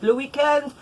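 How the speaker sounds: Le weekend in a light French accent, luh wee-KEND. Shown as a demonstration. Knitter showing viewers Franglais, a light French Le weekend.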